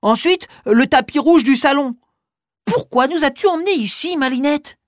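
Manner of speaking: put-on high curious child voice. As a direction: ask it like a curious kid. Child questions the mouse.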